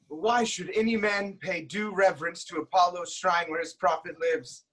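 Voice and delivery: ordinary talk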